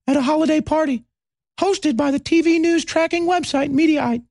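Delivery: squeaky, high parody book reading; mocking Brian Stelter. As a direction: read it like he's inhaled helium, mocking him. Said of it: "we'll do it in the Brian Stelter voice".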